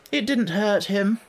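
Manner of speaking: high, slow, offhand drawl; careless. A narrator's reading. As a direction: brush it off as of no importance. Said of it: "the unicorn said carelessly".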